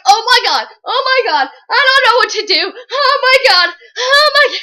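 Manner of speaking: high, loud panicked babble; freaking out. Retold or imitated. Reader panicking while Triss hangs on. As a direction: panic for the character hanging on. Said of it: "you're just freaking out".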